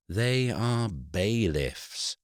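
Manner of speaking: "bailiffs" stressed, slow and deliberate; emphasized word. A narrator's reading. Solicitor spelling it out to Eric, slow stress on "bailiffs". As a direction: lean hard and slow on "bailiffs". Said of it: "Mister Eaves emphasized the word".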